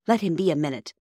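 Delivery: usual speaking voice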